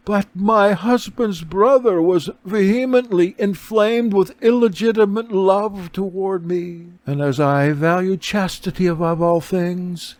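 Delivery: a noble lady recounting her ruin; beggar woman's account. A narrator's reading.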